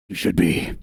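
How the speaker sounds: low put-on chicken voice; ominous low reply in a chicken's mouth; voicing the chicken. Commander secretly voices the chicken to its owner, low put-on voice. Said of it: "He's the one doing the voice for the chicken".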